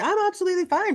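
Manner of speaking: high, loud show of being fine; pretended fine. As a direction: insist brightly, overdoing the cheer. Faked reassurance, nurse's expected answer.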